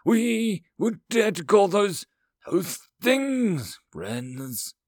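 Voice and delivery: slow, high, scornful outrage; lashing out. A narrator's reading. Alien counselor rejecting those species as friends, scornful. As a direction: drag out the words in disgust. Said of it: "Lazi lashed out".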